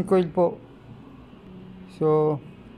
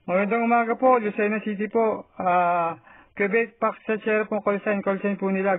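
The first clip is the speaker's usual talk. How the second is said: raised, brisk on-air call; radio operator voice; 3 semitones higher than usual. Acted. Calling on ham radio.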